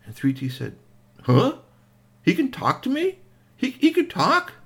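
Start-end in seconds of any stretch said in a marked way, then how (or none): 1.2-4.5 put-on amazed child. Child amazed the robot talks.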